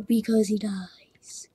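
soft breathy whisper; whispered aside. Real, not acted. Gamer adding that the character dies, hushed whisper. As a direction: drop to a whisper for the punchline.

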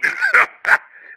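a sudden high wheezing cackle; wheezing pirate cackle. Acted. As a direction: cackle at the frightened young man. Old pirate laughs at him.

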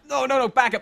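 usual speaking voice

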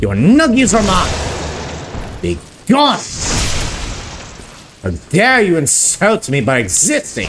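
slow, high mock-holy battle cry; mock biblical prophet. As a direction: gloat like a triumphant paladin.

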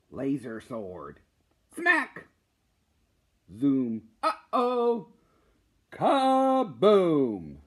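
loud, lively storybook reading with sound effects; animated comic read-aloud. Acted. Teacher reading a student's comic to the class, animated. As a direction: bring a kid's comic to life.

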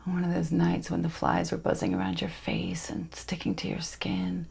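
soft, breath-heavy stage reverie; hushed dramatic monologue. Acted. Miranda recalls stormy nights to her listener, hushed and wistful. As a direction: draw it out softly, with long pauses.

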